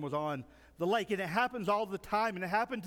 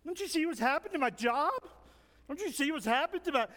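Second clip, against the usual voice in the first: high-pitched whining to Jesus; hammed-up, needy high whine; 7 semitones higher than usual. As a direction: pile up complaints in a whiny plea. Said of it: "start whining to Jesus".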